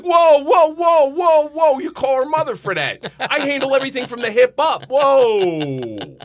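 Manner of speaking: very high, very loud alarmed yelling; a dad recoiling from the doctor's question, yelling high and loud; panicked shout. Retold or imitated. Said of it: "I'm like".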